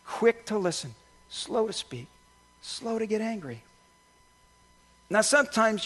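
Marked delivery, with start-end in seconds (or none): 0.0-3.6 quiet repeat. Shown as a demonstration.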